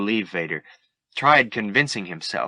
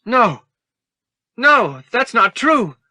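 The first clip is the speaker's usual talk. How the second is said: much higher, louder desperate denial; disbelieving cry; 14 semitones higher, 9 dB louder, slower than usual. Acted. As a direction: refuse to hear it. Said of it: "Luke said, refusing to believe".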